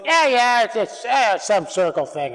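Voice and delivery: lightly put-on local voice, high start falling away; imitated dismissive host. Host shrugging off the circle thing, lightly imitated. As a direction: start high on "yeah, yeah", then let it drop.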